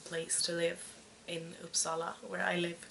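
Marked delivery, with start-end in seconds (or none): none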